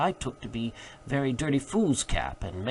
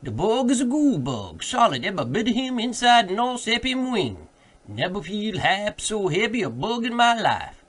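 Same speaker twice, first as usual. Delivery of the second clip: much higher and louder, a put-on character voice; an old servant insisting over his master; loud, high-pitched protest in dialect; Jupiter's voice; 8 semitones higher, 5 dB louder than usual. A narrator's reading. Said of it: "here interrupted Jupiter".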